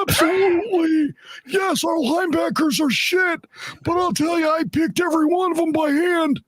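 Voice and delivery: very high-pitched put-on impersonation voice; Trent Baalke. Radio host mocking Baalke defending his linebackers, high-pitched impersonation. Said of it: "Will you do it in your bulky voice?".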